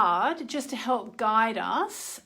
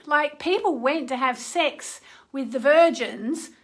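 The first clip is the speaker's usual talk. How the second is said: ordinary delivery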